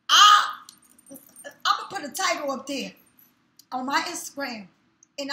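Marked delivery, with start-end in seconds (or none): 0.1-2.0 outburst. Real, not acted. Heated rant peak.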